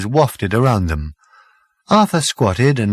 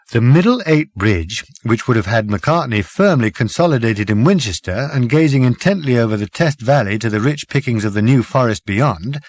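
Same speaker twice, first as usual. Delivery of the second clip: usual speaking voice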